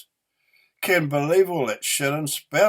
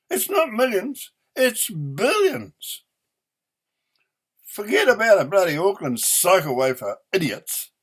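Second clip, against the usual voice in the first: angry shouting; 5 semitones higher, 3 dB louder than usual. Real, not acted. Ranting about spending.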